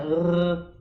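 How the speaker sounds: long "R" rolled as if gargling; gargled R. Shown as a demonstration. Teacher showing students a mouthwash-like French R, gargled. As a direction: sustain the "R" like rinsing the mouth. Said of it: "como se você tivesse bochechando".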